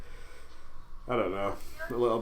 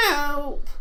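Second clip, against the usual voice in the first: high-pitched mock scream for help; retold scream. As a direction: imitate a girlfriend screaming for rescue. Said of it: "just screaming, going".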